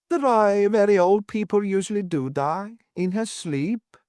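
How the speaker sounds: high-pitched, sorrowful landlady; distressed Mrs Worth, sad. A narrator's reading. Landlady telling how her lodger died, high and sad. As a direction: answer high, slowing at the end.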